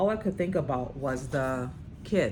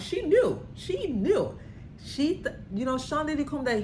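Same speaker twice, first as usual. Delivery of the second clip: excited; 11 semitones higher than usual. Real, not acted. Host to audience, excitedly repeating that she knew.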